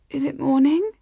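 groggy, rising murmur of a question; slurred, sleepy. A narrator's reading. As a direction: slur it as if just woken. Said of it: "she asked in a slurred voice".

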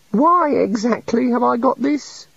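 high meek little god. A narrator's reading. The little god confesses to the wizards, meek and high. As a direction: play a shy, sorry deity.

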